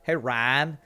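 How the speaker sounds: "[name]" squashed into one syllable, like "ran"; Texan pronunciation of [name]. Retold or imitated. Newcomer imitating Texans calling him, "[name]" said as "Ran". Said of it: "everybody called me [name]".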